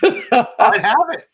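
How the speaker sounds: laughing it out, high and slow; a laughing reply about having bail money. Real, not acted. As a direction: say it through a laugh.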